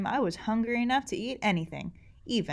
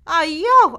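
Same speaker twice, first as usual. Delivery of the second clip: very loud, high ah yo; startled yell; 9 semitones higher, 13 dB louder than usual. Acted. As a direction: yelp as the stone splashes. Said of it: "I yelled".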